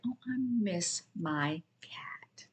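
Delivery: slow whispered longing. Shown as a demonstration. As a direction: breathe it out, slow and voiceless. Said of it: "you could say".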